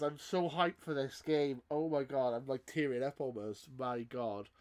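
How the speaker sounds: usual speaking voice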